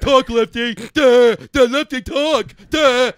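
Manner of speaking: loud, slow mock heckling of the co-host. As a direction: shout it out like a pesky heckler. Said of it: "not be like".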